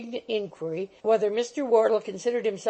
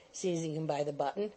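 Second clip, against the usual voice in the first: usual speaking voice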